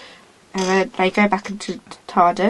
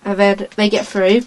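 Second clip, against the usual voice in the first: normal talking voice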